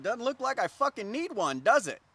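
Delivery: loud, high-pitched indignant sarcasm; exasperated retort. Retold or imitated. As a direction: fire back a sarcastic imagined comeback. Imagined retort he resisted saying.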